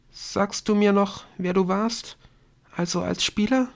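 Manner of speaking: put-on high, tentative girl's voice; small, high-pitched questioning voice; timid girl. A narrator's reading. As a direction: ask shyly in a high register. Girl timidly asks a last question.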